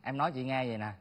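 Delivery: low, very soft self-justification; subdued explaining. Acted. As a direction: drop your voice and explain meekly. Explains meekly to the elder. (phân trần nhỏ nhẹ)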